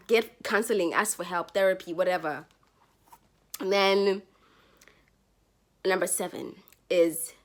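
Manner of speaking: ordinary talk, nothing marked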